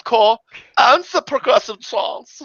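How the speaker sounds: high mock German, drawn out; hammed-up German doorman, slow and high; German accent. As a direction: savor it in an imitated German accent. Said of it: "interacting with a German".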